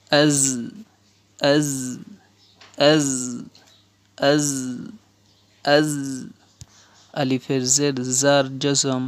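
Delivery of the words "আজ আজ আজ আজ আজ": "az" with a long buzzing z; "az" repeated singly, with pauses; drilled "az". Shown as a demonstration. Teacher shows alif zabar za jazm, drilling "az". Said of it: "উচ্চারণ".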